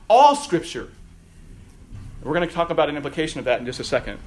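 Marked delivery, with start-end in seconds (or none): 0.1-0.9 emphatic. Real, not acted. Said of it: "which Scripture?".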